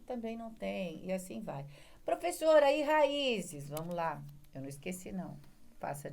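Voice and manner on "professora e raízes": student asking. Acted. Voicing a student's question.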